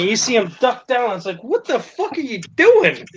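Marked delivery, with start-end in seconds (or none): 1.4-3.0 high-pitched incredulous question; mock-bewildered, voice jumping up; baffled disbelief. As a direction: react as if utterly thrown. Baffled as boss ducks down.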